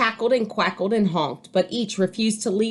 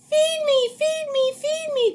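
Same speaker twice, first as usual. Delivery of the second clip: put-on very high, squeaky baby demands; hungry duckling. A narrator's reading. Baby duck begs Jack for food, very high. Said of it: "cried the baby duck".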